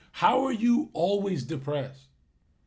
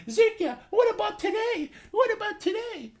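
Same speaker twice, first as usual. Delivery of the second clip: much higher, nagging whine; whiny fan voice; 3 dB louder than usual. Retold or imitated. Mocking a pestering viewer nagging him to go live. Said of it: "I've never seen a fucking streamer get more".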